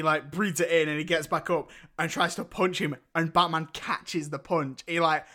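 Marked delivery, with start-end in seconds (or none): none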